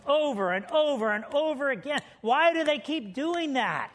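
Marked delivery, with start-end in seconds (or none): none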